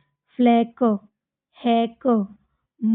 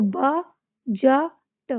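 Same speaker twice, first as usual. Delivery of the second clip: ब-ज-ट broken into slow separate syllables; slow phonics breakdown; much slower than usual. Shown as a demonstration. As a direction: sound it out piece by piece for kids. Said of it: "डी को silent करेंगे".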